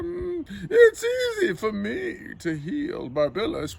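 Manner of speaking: high, crooning show-off voice; boastful astrologer. Acted. Astrologer claiming he can easily heal Barbilus, boastful. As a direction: brag in a high, theatrical voice.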